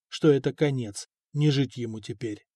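ordinary delivery, nothing marked

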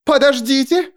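high gasped plea, rising at the end; frightened gasp. A narrator's reading. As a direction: gasp it with a blade at your throat. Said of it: "ахнула епископ".

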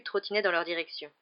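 ordinary delivery, nothing marked

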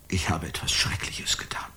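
low whispered confession; horrified whisper. Acted. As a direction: breathe out the confession. Confession of a terrible deed. (geflüstertes Geständnis)

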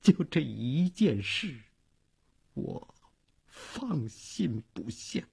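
faint, halting last words; weak deathbed voice, running out of strength; dying, near sobbing. A narrator's reading. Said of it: "话没说完， 他呜咽了几声".

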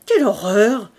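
loud horrified exclamation; cri d'horreur. A narrator's reading. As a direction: cry out in disgust. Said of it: "s'écria Fantine". (exclamation horrifiée, à voix forte)

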